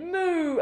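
high drawn-out moo; cow moo. A narrator's reading. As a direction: imitate a cow mooing high. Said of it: "Then the cow said".

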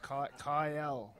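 slow correction, Kyle stretched into two syllables, Ky-el; careful correction. Shown as a demonstration. Fighter correcting the hosts on his name, slow with Kyle drawn out as Ky-el. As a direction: correct them slowly, dragging out Kyle as Ky-el. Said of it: "that's not two syllables".

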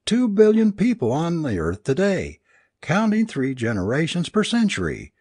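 high-pitched, distraught tirade; raving. A narrator's reading. Alice protests being picked for a cosmic joke, raving. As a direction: wail in outrage at the injustice. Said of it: "Alice raved".

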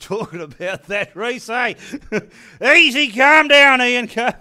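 laughing, high and loud, barely holding it together; too excited to talk straight, laughing through it; giddy laughing squeal, trying to calm himself down; giddy excitement. Real, not acted. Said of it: "I'm getting excited just".

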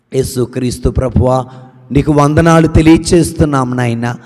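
normal talking voice

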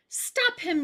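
high loud yell. Acted. Gardener and pizza eater yelling, high and loud. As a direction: shout after him. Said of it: "yelled the gardener".

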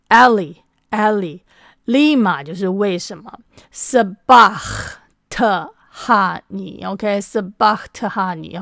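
very slow Aramaic recitation, syllable by syllable; Aramaic pronunciation. Shown as a demonstration. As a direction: teach Sabachthani slowly and clearly. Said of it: "所以我教大家念一次啊". (一字一字慢慢念阿拉姆语)

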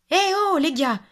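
proud little hen calling out. Acted. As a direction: shout it across the farm.

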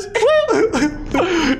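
squealed. Pretend. High squeal of surprise.

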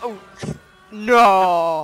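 anguished shout. Real, not acted. Player getting killed, loud anguished no. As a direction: yell it as you die. Dying in game.

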